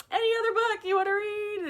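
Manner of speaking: high, quick, loud retold question; hopeful dodge. Mom retells asking son for another book, high hopeful plea. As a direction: pitch it way up, steering the child elsewhere.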